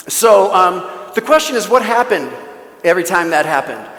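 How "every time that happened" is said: normal talking voice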